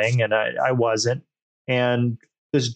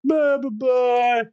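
much higher brushing-off imitation; dismissive mock voice; 10 semitones higher, slower than usual. S0 mocks the guy backing down, much higher dismissive voice. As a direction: wave him off, way up high, blah blah.

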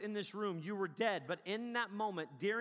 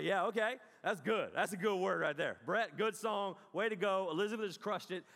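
louder, growing approval; ramping-up worship; 4 dB louder than usual. Retold or imitated. Worshiper ramping up a little bit, cheering the singers. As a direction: cheer the band on with rising energy. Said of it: "ramping up my worship a little bit".

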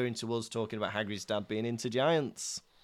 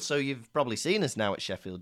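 usual speaking voice

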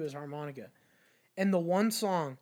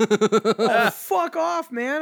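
louder, higher dismissive "fuck off"; mock brush-off; 6 semitones higher, 11 dB louder, much slower than usual. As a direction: wave off a pushy busker. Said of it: "I go".

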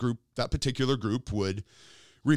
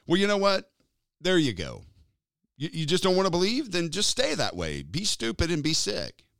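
higher, fed-up sarcasm; dismissive, higher mocking voice; sarcastic mock God; 7 semitones higher than usual. Mock God giving up on them.

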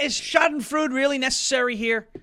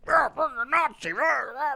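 higher, softer mock jeering; mocking heckler; 5 semitones higher, 3 dB quieter than usual. Mocking Democrats yelling insults at him. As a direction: sneer it like a lazy heckler.

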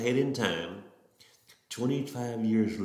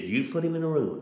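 higher-pitched, mildly emphatic retold insistence; faith talking; 6 semitones higher, 3 dB louder than usual. Mother answering hospital staff about her son, faith talking. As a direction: retell her instruction with firm faith. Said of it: "Now here's faith talking".

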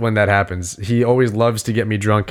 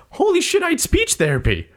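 sudden realization. Retold or imitated. Acts out his sudden realization.

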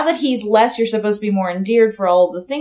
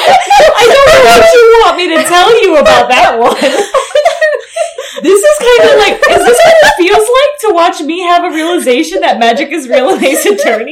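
talking through laughter; high, loud laughing speech; 8 semitones higher, 7 dB louder than usual. Real, not acted.

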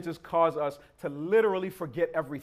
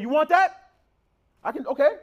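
high, loud incredulous haggling; sarcastic offer; 11 semitones higher, 8 dB louder than usual. Acted. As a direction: offer it up in exaggerated disbelief. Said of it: "Y'all hear the sarcasm now".

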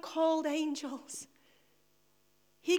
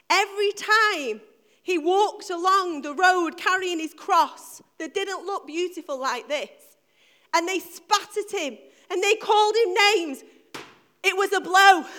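preaching at full force; 2 semitones higher, 6 dB louder than usual. Acted. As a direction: drive each phrase up to the word "blow".

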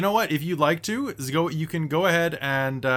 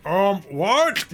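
high, puzzled shark voice; a confused sea creature asking; talking animal; 5 semitones higher, 4 dB louder than usual. Acted. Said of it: "the shark is like".

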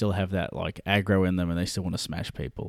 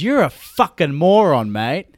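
angry insult; 6 semitones higher, 7 dB louder than usual. Retold or imitated. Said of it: "my dad goes".

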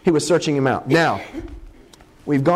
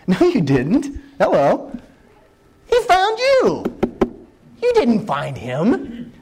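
no you didn't, with a big squeal up on he found you; high mock-incredulous protest; emphatic correction; 7 semitones higher, slower than usual. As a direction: set him straight, half teasing. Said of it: "I'm looking at Philip and going".

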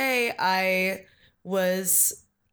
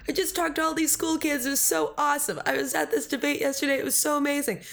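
higher-pitched, bubbly retelling of good news; gushing excitement; 7 semitones higher, faster than usual. Retold or imitated. Candidate retelling her happy phone call to her boss, gushing. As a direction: gush as if recounting a thrilling day.

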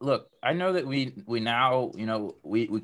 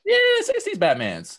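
squeaky excited; 4 dB louder than usual. Real, not acted. Playful Batman list.

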